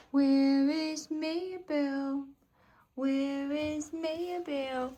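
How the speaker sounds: slow sing-song hide and seek; sing-song peekaboo. Real, not acted. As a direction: stretch it out slowly like peekaboo. Peekaboo with the pet.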